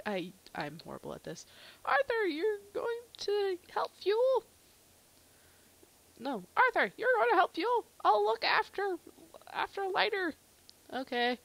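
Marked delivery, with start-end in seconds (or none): 1.9-4.4 high, slow, hammed-up voice; pleading character voice. Thomas sends Arthur to help Fuel, high hammy voice. 6.5-10.3 high, loud, hammed-up delivery; urgent character voice. Thomas will look after Lighter, high loud character voice.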